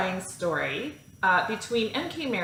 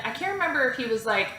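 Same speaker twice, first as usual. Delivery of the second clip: usual speaking voice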